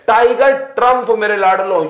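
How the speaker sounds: ordinary talk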